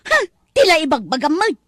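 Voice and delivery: wife protesting loudly; loud complaint. Acted. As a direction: complain loudly, half crying. Wife complains about kitchen role.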